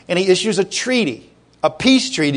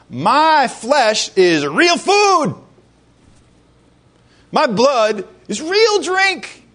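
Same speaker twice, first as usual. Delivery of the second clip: solemn proclamation; 7 semitones higher, much slower than usual. Retold or imitated. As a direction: spell each word out slowly to the crowd. Jesus proclaims to crowd.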